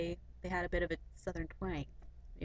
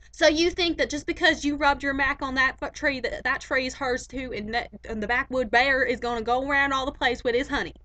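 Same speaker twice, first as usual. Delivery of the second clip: louder, higher hammed-up folksy lecture; Doctor Phil comparison; 6 semitones higher, 11 dB louder than usual. Vlogger invents a Doctor Phil comparison, loud mock folksy lecture. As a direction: do a made-up Doctor Phil saying. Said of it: "I'll give you an example".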